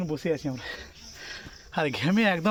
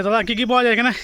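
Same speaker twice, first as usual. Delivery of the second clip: calling out; 4 semitones higher, 9 dB louder, faster than usual. Real, not acted. Calling out to drink vendor.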